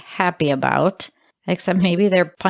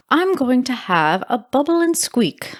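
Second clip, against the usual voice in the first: higher-pitched, badly faked British accent; mock British, pitched up; terrible British accent; 5 semitones higher than usual. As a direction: put on a hammy, unconvincing British accent. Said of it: "I know that's a terrible accent".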